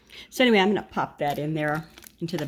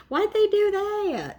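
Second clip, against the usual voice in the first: puzzled; slower than usual. Real, not acted. Puzzled at a decor choice.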